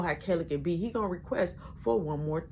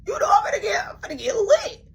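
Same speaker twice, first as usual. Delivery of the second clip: hyped squeal; 14 semitones higher, 5 dB louder than usual. Retold or imitated. Excited about the shotgun.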